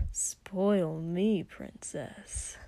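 low breathy whisper, almost no voice; teasing whispered hiss. Acted. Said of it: "Katsuki hissed".

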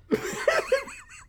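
high burst of laughing; burst of laughter. Real, not acted. Laughing at Paul.

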